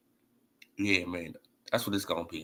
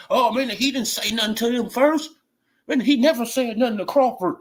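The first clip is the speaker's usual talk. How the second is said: higher, louder mocking of fans; mock fan excuse; 6 semitones higher, 3 dB louder than usual. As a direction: parody the fans defending their fighter.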